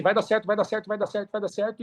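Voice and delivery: repetição insistente em tom mais agudo; otimismo teimoso. Retold or imitated. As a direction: imitando a própria teimosia confiante. Imita o próprio otimismo teimoso. (insistência otimista)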